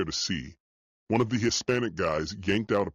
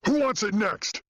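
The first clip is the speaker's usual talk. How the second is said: roar; 13 semitones higher than usual. Acted. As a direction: bellow it at the men on the floor. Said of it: "Mahoney roared".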